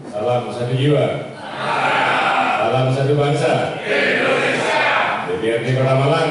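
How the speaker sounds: loud chanted salute; rally speech. Real, not acted. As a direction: call out the salute to the crowd. Chairman leads crowd salute chant.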